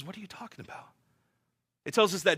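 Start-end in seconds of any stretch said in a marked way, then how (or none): 0.0-0.9 quiet, unbothered question from Jesus; soft, calm Jesus. Acted. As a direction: ask it softly, as if nothing is wrong. Jesus woken in the storm.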